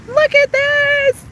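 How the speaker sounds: loud, high, drawn-out exclamation; amazed excitement. Real, not acted. Streamer showing viewers a stunning view, amazed.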